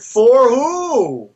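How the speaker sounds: quoting yourself with a pointed challenge; pointed question. Retold or imitated. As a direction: toss the question back at him. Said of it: "I said".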